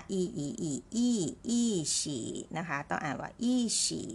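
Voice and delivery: อี repeated, then falling อี้ in อี้ฉี่; drilling the tone shift. Shown as a demonstration. Teacher drilling students on the fourth tone. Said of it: "อ่านเสียง อี เป็นเสียง สี่".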